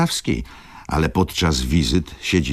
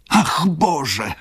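much higher, anguished "Ach, Boże!" cry; 13 semitones higher than usual. A narrator's reading. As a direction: fling "Ach, Boże!" out high and strained. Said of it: "krzyknęła znowu pani baronowa".

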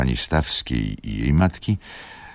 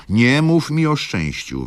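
higher, louder angry outburst; bursting out at her daughter, higher and louder; 12 semitones higher, 5 dB louder than usual. A narrator's reading. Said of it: "wybuchnęła matka".

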